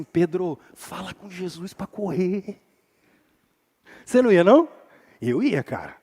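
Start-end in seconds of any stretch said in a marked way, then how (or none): 0.0-2.6 hushed urging. Pretend. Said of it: "Eu ia chegar e falar bem assim".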